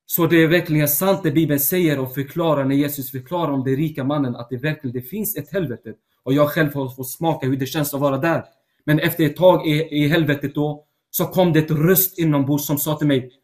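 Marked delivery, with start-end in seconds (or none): none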